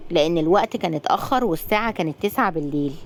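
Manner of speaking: ordinary delivery, nothing marked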